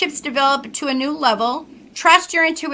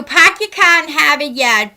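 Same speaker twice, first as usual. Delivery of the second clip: loud showpiece Massachusetts accent with dropped R's; 5 dB louder than usual. Shown as a demonstration. Woman demonstrating how some locals speak, broad Massachusetts accent. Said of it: "not everybody speaks that way".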